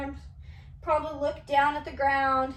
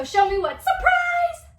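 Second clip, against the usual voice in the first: excited shout; 7 dB louder than usual. Acted. Teacher exciting kids for surprise.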